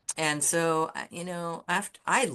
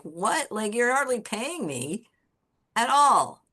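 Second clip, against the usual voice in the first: high, incredulous outburst, louder at the end; indignant retort; 8 semitones higher, 5 dB louder than usual. Retold or imitated. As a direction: retell a protest that climbs in pitch and volume. Retort to boss cutting pay.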